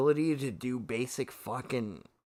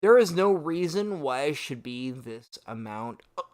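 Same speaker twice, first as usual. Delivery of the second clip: ordinary talk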